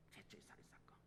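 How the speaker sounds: barely audible whisper; hushed, voiceless echo; whispered repeat. Real, not acted. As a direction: let it fade to a breath of a whisper.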